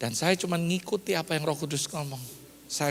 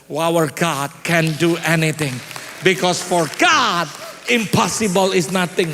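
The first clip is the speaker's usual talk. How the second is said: loud, slow proclamation; forceful preaching; 7 dB louder, slower than usual. Real, not acted. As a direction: proclaim it slowly and loudly to the congregation. (khotbah lantang, lambat dan penuh tekanan)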